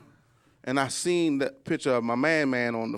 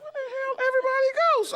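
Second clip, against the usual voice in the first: surprised; faster than usual. Retold or imitated. Stunned at empty Walmart.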